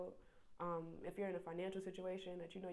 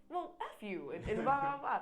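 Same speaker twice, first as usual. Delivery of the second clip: louder, higher hammed-up snap-back; mock angry retort; 7 semitones higher, 8 dB louder than usual.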